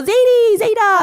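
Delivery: put-on high, loud childish call of "Zaidi"; kids calling grandpa. Kids climbing on stage, calling to grandpa, high and loud. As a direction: call out like an excited little kid. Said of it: "like, you know".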